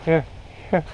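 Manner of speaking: panicked pleading, frantic; terrified gibbering. Real, not acted. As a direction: beg the monkey in panic. Monkeys climbing on her.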